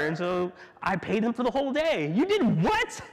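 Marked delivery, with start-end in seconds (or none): 2.1-3.0 high, disbelieving "what"; stunned bookkeeper question; shocked accountant. Acted.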